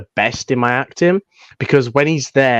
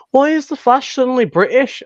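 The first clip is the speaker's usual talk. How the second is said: high, bemused imitation of confused viewers; mock puzzled question; 10 semitones higher than usual. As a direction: pitch it up like a baffled fan. People puzzled by British Flash.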